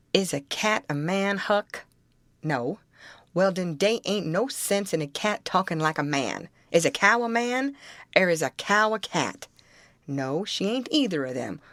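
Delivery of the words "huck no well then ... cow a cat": ordinary delivery, nothing marked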